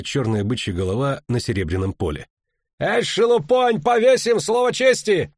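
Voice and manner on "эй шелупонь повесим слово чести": high, slow, drawn-out threat; ringing roar. A narrator's reading. Knight threatening to hang riffraff, ringing roar. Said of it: "звонко рыкнул один из рыцарей".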